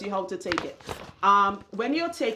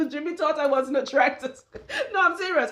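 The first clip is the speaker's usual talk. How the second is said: laughing; 7 semitones higher than usual. Real, not acted. Laughing about her fibroid story.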